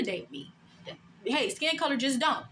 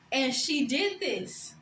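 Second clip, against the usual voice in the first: normal talking voice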